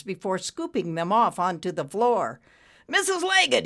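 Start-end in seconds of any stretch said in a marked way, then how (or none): 2.9-3.6 high, loud summons; shout. A narrator's reading. Boss calling his secretary, shouted. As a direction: yell for the secretary. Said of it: "he shouted".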